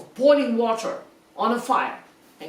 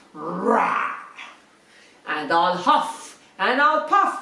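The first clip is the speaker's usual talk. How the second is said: growling wolf; 3 semitones lower, slower than usual. Acted. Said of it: "he said".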